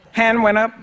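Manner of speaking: ordinary talk, nothing marked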